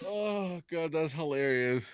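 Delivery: usual speaking voice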